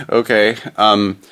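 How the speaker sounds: usual speaking voice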